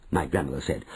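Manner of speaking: usual speaking voice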